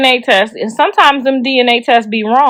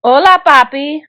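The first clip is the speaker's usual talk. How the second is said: put-on Mexican accent, a flirty greeting; 3 semitones higher than usual. Shown as a demonstration. Phone worker greeting a caller, mock Mexican voice. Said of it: "and your Mexican voice".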